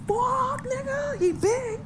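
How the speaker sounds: high cracking squeal of fear, half crying; terrified squeal. Retold or imitated. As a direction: squeak it out like you're too scared to breathe. Son says he's so big.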